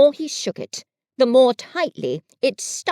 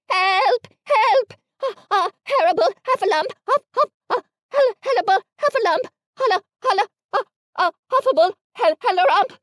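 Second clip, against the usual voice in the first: very high, fast, loud stuttered screams; tripping over the words in terror; stammering panic; 10 semitones higher, 4 dB louder, faster than usual. A narrator's reading. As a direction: Piglet scampering off, shrieking. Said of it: "still crying out".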